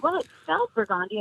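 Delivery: "Burgundy" sounded out as bur-GAHN-dee; mispronounced name. Retold or imitated. Her answering people, "Burgundy" said as bur-GAHN-dee. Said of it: "scared to mispronounce my name".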